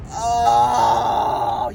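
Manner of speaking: long, drawn-out mock "oh"; exasperated groan. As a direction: groan it out in disbelief. Sees her opponent is elderly, exasperated.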